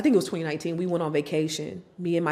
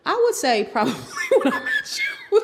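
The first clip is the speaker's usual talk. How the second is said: high, laughing answer; 11 semitones higher, 5 dB louder than usual. Real, not acted. Guest answering host while laughing, high. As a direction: break into laughter as you answer.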